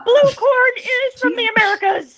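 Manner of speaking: high squeaky gremlin giggle; angry gremlin voice. Pretend. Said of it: "It's my angry gremlin voice".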